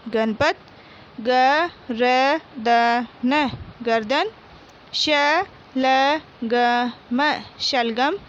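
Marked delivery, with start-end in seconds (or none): 1.2-3.5 गर्दन drawn out very slowly as ग-र-द-न; slow syllable reading. Shown as a demonstration. Teacher reading गर्दन aloud for pupils, very slowly. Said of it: "मौखिक बोलकर पढ़िए". 4.9-7.2 शलगम drawn out very slowly as श-ल-ग-म; slow syllable reading. Shown as a demonstration. Teacher reading शलगम aloud for pupils, very slowly. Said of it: "मौखिक बोलकर पढ़िए".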